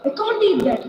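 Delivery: ordinary delivery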